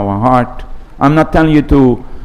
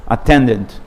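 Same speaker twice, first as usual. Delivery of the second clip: normal talking voice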